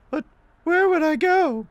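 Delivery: very high falsetto wail; distressed woman. Acted. Woman about to lose her home, high distressed falsetto. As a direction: plead in a high, helpless lady voice.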